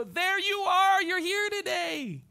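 put-on high, slow, sing-song greeting to a small child; teacher kneeling to greet a child at the classroom door; preschool teacher voice. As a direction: crouch down and beam at a toddler. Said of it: "get down here with the child".

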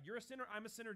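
usual speaking voice

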